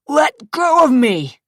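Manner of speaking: high, loud, slow shout; struggling shout. Acted. Zach yells at his attacker, high struggling shout. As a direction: fight him off with your voice.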